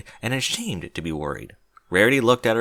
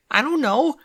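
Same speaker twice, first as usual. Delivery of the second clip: panicked; 13 semitones higher than usual. A narrator's reading. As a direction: blurt it out in shock. Panicked reply after shriek.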